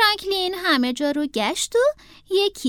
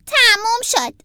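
high, loud little announcement; child declaring he's done; 7 semitones higher, 7 dB louder than usual. A narrator's reading. Franklin tells himself the tidying is finished, loud and high. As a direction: call it out like a boy finishing a chore.

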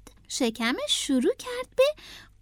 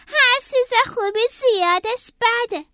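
high, loud rabbit voice; rabbit character; 10 semitones higher, 5 dB louder than usual. A narrator's reading. Howard warning himself about the gum, high emphatic voice. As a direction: voice a rabbit reminding himself firmly.

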